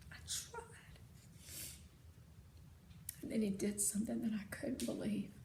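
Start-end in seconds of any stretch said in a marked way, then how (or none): 0.1-1.0 softer, barely voiced; broken whisper. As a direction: whisper it defeated. Father breaking down.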